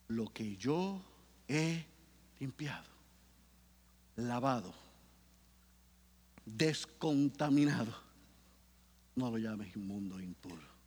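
low, soft, slow answer, each word set apart; calm voice of God. Acted. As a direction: answer gently from above. (Dios responde en voz baja, suave y lenta)

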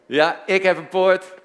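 loud, high-pitched call for the crowd to repeat; rousing call-and-response shout. Shown as a demonstration. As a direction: project it high so everyone joins in. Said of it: "hardop met me meezeggen".

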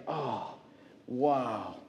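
soft, low murmur of admiration; quietly awed. Retold or imitated. Young teacher silently admiring a colleague's answer, quietly awed. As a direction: think it to yourself, hushed and impressed. Recalls his awed inner thought.

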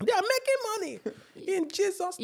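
squeaky high Nigerian mum; proud auntie in a tiny helium squeak. Retold or imitated. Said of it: "Helium voice".

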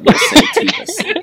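gleeful. Acted. GM grants the town name.

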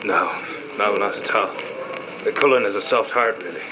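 low, softened, sorrowful defence; quiet and low, protective of a friend; subdued denial. Acted. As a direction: gently deny it, the fight gone out of you. Softly defends friend after outbursts.

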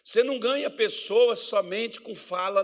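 ordinary delivery

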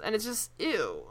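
ordinary delivery

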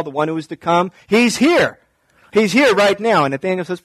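ordinary talk

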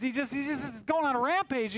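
ordinary delivery

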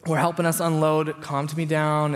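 usual speaking voice

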